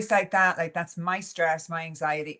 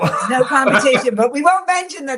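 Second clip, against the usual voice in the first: laughing; 7 semitones higher, 9 dB louder, faster than usual. Real, not acted. Laughing at her own joke.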